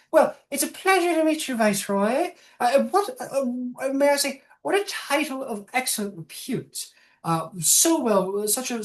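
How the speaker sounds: put-on a fluttery, slightly senile old matriarch; old gnome woman. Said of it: "He goes".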